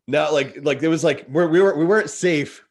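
words tumbling out in rising alarm; agitated. Real, not acted. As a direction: heart still racing from the memory. Reliving danger, voice rising.

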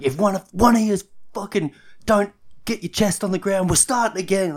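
loud, high mock coach yell; hammed-up training-ground bawling; footy coach. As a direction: yell it like a coach threatening the squad. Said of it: "like, footy training".

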